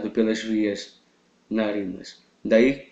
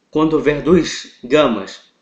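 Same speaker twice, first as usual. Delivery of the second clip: usual speaking voice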